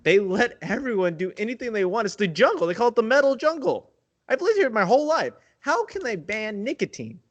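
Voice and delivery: panicked caller pitching up; desperate customer. Pretend. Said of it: "let's play it out".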